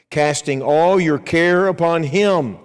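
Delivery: slow, loud, emphatic verse reading; preacher reading verse seven slowly and loudly; scripture reading. Acted. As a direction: proclaim the verse word by word.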